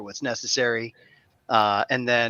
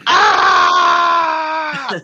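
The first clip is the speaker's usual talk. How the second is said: loud, high "ah" after a gulp; refreshed drinking gasp; 11 dB louder than usual. Shown as a demonstration. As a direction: belt out a satisfied sip sound. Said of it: "to show you guys I'm drinking is go".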